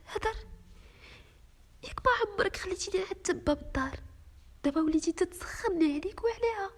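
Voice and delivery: high, tearful voice of a betrayed wife, breaking on the questions; tearful pleading. Acted. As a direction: plead with him through tears, voice cracking high. Wife accuses husband, in tears.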